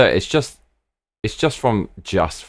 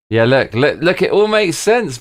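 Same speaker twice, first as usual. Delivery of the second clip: excited insisting; 6 semitones higher, 4 dB louder than usual. Real, not acted. Insists the route plan works.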